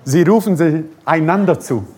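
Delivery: ordinary talk, nothing marked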